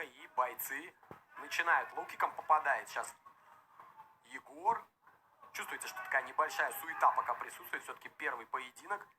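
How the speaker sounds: ordinary talk, nothing marked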